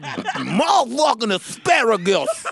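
over-the-top diva. Retold or imitated. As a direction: belt the demand like a sassy diva. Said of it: "I'll do the whole thing".

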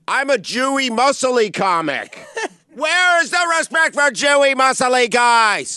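high, loud, slow mock outrage; mock identity plea. Joking to cohost about identity labels, loud mock outrage. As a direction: ham up the indignation. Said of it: "identify as something".

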